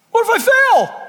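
high, loud mock cry of panic; panicked protest. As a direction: cry out like a frightened doubter. Acts fearful believer balking.

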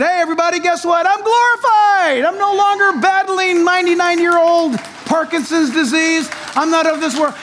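high, exultant announcement, stretched out; jubilant Billy Graham. Acted. Billy Graham announcing from heaven to those below, high and jubilant. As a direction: call the good news down, high and elated. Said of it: "Billy Graham looked over the rainbow there and said".

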